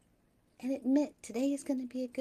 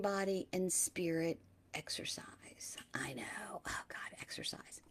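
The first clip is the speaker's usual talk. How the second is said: whisper; 5 semitones lower, 9 dB quieter than usual. Real, not acted. Joking aside about exercise.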